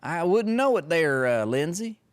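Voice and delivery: sneering singsong echo; mocking. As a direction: taunt him by parodying his folksy boast. Mocking senator with parody echo.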